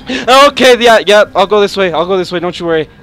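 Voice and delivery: loud nervous reassurance; calm the monster down, loudly; talking loudly to the monster to keep it calm; loud placating. Real, not acted.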